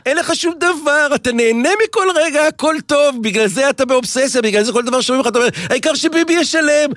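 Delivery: high-pitched, worked-up rant; real exasperated yelling. Real, not acted. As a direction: raise your pitch sharply and rail at him. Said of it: "אין שום בעיה עם הצעקות שלך".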